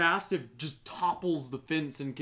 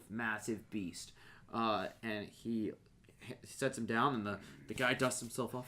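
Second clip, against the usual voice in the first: ordinary talk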